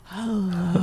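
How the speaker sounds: soft, low-energy greeting; hushed mock low-energy hello. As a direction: imitate a listless, softly spoken hello. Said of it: "way too low of energy".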